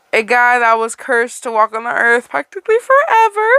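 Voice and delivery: plain reading voice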